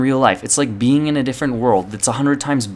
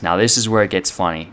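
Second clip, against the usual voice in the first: ordinary talk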